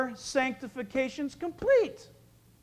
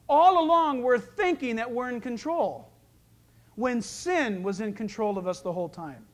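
raised, driving pulpit voice; preaching at full force; 3 semitones higher, 3 dB louder than usual. Acted. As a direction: press the point on the congregation.

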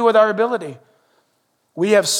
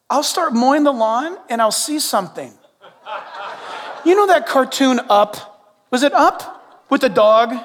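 ordinary delivery

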